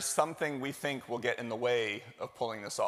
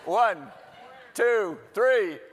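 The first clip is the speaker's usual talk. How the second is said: one, two, three counted higher and louder to hype the crowd; 6 semitones higher, 7 dB louder, much slower than usual. Shown as a demonstration. Host retakes the selfie count-off to hype the audience, louder. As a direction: pump up the count so the room feels it. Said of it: "Let's try that one more time. I wasn't feeling it".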